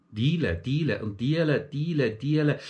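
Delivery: Diele said over and over, Bernese style. Shown as a demonstration. Comedian drilling the Bern word for boy, repeating Diele. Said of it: "ein Bub heisst in Bern Diele".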